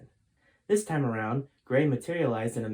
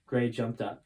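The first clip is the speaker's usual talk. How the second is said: ordinary delivery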